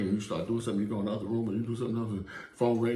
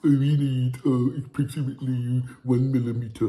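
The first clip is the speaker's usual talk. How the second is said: higher, louder prissy voice; bougie voice; 6 semitones higher, 8 dB louder than usual. Pretend. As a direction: sound fussy and proper. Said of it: "You don't gotta be like".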